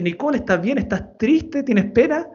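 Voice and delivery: dad gently asking his tearful daughter if she is sad; concerned questions. Retold or imitated. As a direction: check on a crying child tenderly. Dad sees daughter's tear.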